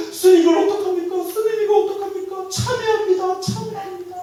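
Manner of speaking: high, anxious repeated plea; hammed-up panic; mock pleading. Frightened laywoman begs the monk what to do, mock panic.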